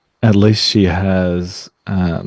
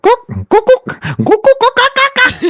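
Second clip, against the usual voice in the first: high, building kookaburra cackle; kookaburra call; 5 dB louder than usual. Shown as a demonstration. Co-host demonstrating the joke option as a kookaburra call. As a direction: imitate a kookaburra's rattling call. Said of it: "the kookaburra sounds".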